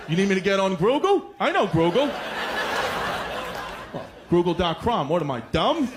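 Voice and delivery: extra R's, say "Google" like "Grugle"; dad's accent. Retold or imitated. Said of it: "I said".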